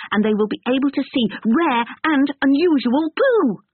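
put-on high, fast eager boy voice; tumbling out his hobby, fast; excited young boy.

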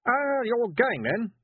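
put-on high, slow, awkward young man; hesitant lovestruck boy; nervous boy.

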